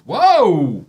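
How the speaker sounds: loud, high startled exclamation; suddenly startled whoa. Real, not acted. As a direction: jump at the sudden noise. Startled by outburst.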